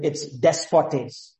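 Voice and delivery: despotes said slowly, every syllable drawn out; Greek word spoken. Shown as a demonstration. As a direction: linger over despotes, stressing the Greek. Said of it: "the Greek word there is not kurios".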